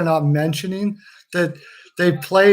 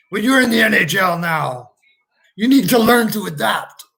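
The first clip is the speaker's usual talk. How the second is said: higher, put-on lecturing coach; acted reprimand, pitched up; mock coach's scolding; 4 semitones higher, 3 dB louder than usual. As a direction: lay down the law as a hammed-up coach. Coach scolding the player in answer.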